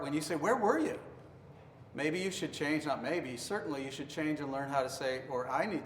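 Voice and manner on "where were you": high-pitched accusing question. Shown as a demonstration. Speaker quoting a spouse's question, accusingly. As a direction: throw it out as a pointed accusation. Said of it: "accusational sort of tone".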